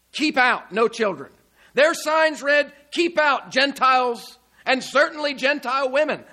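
loud, high preaching proclamation; forceful mock declaration of exclusion; exclusive insiders. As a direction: shout the outsiders down as club members. Preacher voicing exclusive club members.

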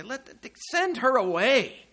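mock the disciples demanding she go; indignant disciples. Acting out the annoyed disciples.

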